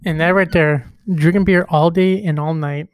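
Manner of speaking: belting it out, thrilled by the promo; hyped. Real, not acted. Hyped fan repeating the promo line.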